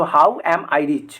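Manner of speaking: how am I rich drawn out slowly word by word; slow model answer. Shown as a demonstration. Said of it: "अच्छा, यहाँ पे अमीर आ गया ना". (धीरे-धीरे उत्तर बताना)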